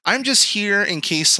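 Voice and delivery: high, whiny mock woman's voice; mock girl's letdown. Mocking woman's voice continues.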